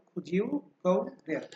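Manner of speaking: could you as "kujiu", slowly, then go there; linked American pronunciation. Shown as a demonstration. Teacher repeats how they say it, slow linked could you. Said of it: "ওরা বলে".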